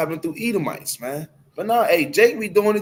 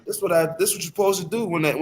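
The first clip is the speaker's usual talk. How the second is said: ordinary delivery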